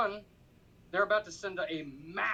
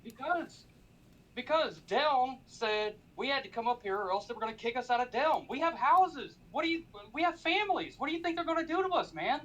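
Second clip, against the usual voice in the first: desperate plea; 6 semitones higher than usual. Acted. Captive begs for his life.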